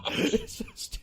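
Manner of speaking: laughing. Real, not acted. Laughing after the impression.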